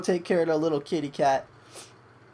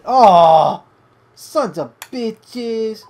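startled yell; 14 dB louder, much slower than usual. Real, not acted. Sudden shock during gameplay.

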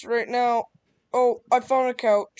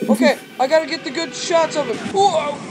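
excited; 4 semitones higher, 6 dB louder than usual. Real, not acted. Filming the monster.

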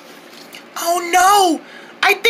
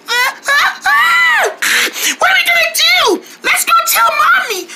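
panicked shouting; 9 semitones higher than usual. Acted. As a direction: panic and call for help. Running to Mommy in panic.

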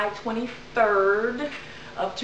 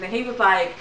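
ordinary talk

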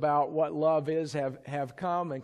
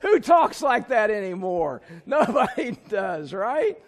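laughing; 5 semitones higher, 8 dB louder than usual. Real, not acted. Laughing at the cheesy lyric.

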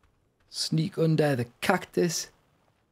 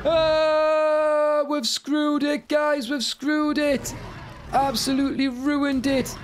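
higher-pitched cry of dismay; real dismay; 7 semitones higher, much slower than usual. Real, not acted. Player to teammates after screwing it up, dismayed cry. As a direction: wail that it has all gone wrong. Game going wrong, cries out.

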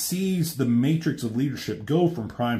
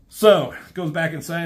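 ordinary delivery

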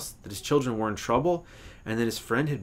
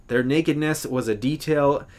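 plain reading voice

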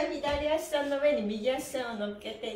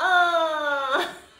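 rallying cheer; 5 semitones higher, 8 dB louder than usual. Acted.

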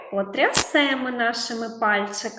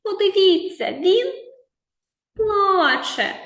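animated storytelling; 8 semitones higher, 4 dB louder than usual. Shown as a demonstration. Teacher draws attention to crying boy.

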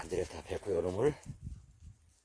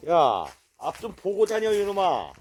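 scolding call; 6 semitones higher, 5 dB louder, slower than usual. Real, not acted. Scolding a careless dog.

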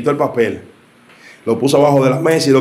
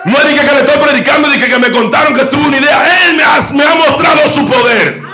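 high, loud, fervent preaching shout; full-force preaching; 12 semitones higher, 3 dB louder than usual. Acted. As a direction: lift the church with a roaring testimony. (predicación a todo pulmón, alta y gritada)

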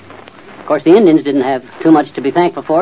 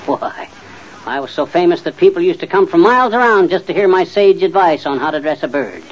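ordinary talk

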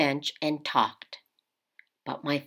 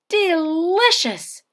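slow, savoured, higher; delighted; 11 semitones higher, slower than usual. Acted. Father tasting sukiyaki for the first time, slowly delighted.

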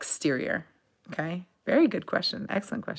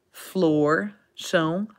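usual speaking voice

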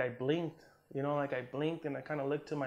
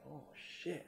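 hushed; 13 dB quieter than usual. Retold or imitated. Realizing he can't stand.

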